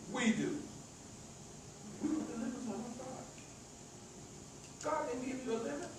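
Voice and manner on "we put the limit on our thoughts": much softer, reflective echo; hushed repeat. Real, not acted. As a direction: drop right down in volume, end rising.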